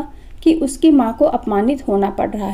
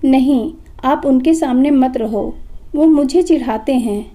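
ordinary delivery, nothing marked

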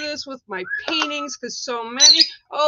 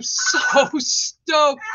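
slow, laughing through the words; laughing delight; 3 semitones higher, 3 dB louder than usual. Real, not acted. Host tells viewers she is stoked, slow and laughing. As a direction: savour it with a giggle.

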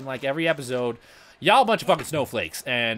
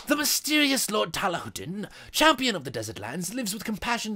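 grand fight-announcer introduction; dramatic announcer; 3 semitones higher than usual. Acted. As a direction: present a mysterious champion with theatrical flourish. Introduces game character dramatically.

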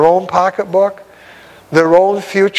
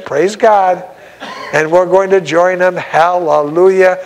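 ordinary delivery

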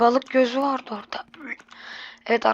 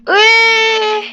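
high loud "eww" squeal; disgusted squeal; 11 semitones higher, 9 dB louder than usual. Real, not acted. Disgusted at slimy nose.

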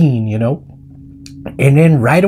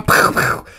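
puffed mouth gunshots; imitated gunshots. Cowboy capping his outburst, mock gunshots. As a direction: puff two pretend pistol shots.

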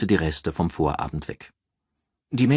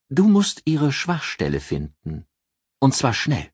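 raised voice for a calculating friend giving advice; Ann, cool strategist; 8 semitones higher than usual. Acted. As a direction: lay out the plan like a cool strategist. Ann advising Henri.

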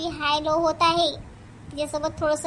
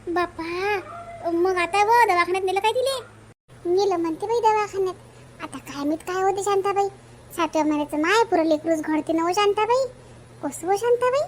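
high-pitched village woman spreading worried news; a fretful neighbour, voice pitched up, eager to tell; anxious village gossip; 4 semitones higher than usual. Acted. Ganga tells Shantabai about the pregnant girl's fall, high and fretful.

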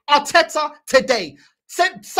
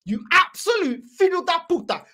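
usual speaking voice

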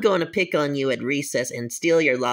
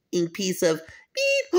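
normal talking voice

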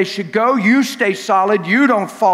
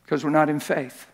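usual speaking voice

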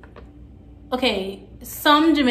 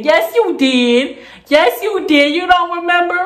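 loud, higher-pitched mimicked insistence; mock insistent retort; 7 semitones higher, 6 dB louder than usual. Boy contradicting her before the teacher, mock insistent.

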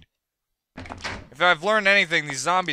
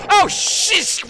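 high, loud shriek of alarm; startled shout; 7 dB louder than usual. Real, not acted. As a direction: yelp as something jumps out at you. Zombie attacks.

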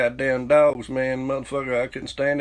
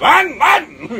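high, loud mock yell at the dog; yelling run; 14 semitones higher, 6 dB louder than usual. Uncle Danny yelling at the dog from his car, loud high shout. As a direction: bellow it out to scare the dog.

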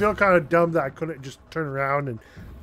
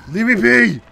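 loud, higher plea to be let go; desperate shout; 5 semitones higher, 10 dB louder than usual. Real, not acted. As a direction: yell to shake them off. Caught by enemies.

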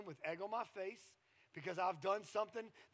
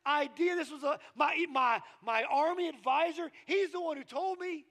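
loud, raised-pitch self-justifying protest; defensive, emphatic insistence on being right; stubbornly insistent; 7 semitones higher, 9 dB louder than usual. Acted. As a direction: dig your heels in and argue it forcefully. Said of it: "I'm digging my heels in".

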